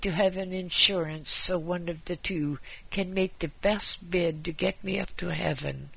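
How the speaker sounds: wry, resigned sickbed bargaining. Acted. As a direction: give in to mamma with an old Yiddish lilt.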